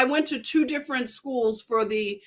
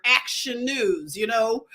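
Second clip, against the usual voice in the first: ordinary talk, nothing marked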